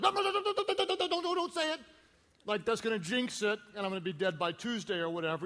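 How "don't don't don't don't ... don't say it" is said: high, rapid-fire imitation of an alarmed wife; shrill-pitched string of frantic mock objections; mock panicked protest. Wife stopping talk of his death, mock panicked. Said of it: "she's always like".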